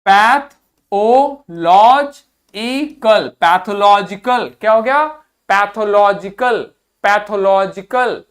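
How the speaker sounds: pathological very slowly, each syllable stretched; slow drawn-out drill. Shown as a demonstration. Teacher drills pathological very slowly for students. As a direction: stretch pathological out for learners to copy. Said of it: "इसको देखिए".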